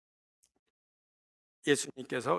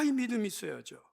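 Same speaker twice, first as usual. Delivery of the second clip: usual speaking voice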